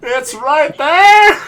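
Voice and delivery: loud, high, stretched-out wail; agonised yell. Real, not acted. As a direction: wail slowly at the near miss. Agonising over near miss.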